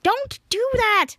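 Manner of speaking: high, drawn-out mock fright; play-acted startled scolding. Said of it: "she jumps and gets scared".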